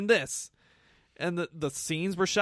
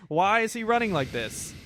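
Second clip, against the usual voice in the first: usual speaking voice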